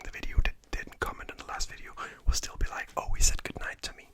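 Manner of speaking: ASMR whisper. Acted. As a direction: whisper good night gently, like ASMR.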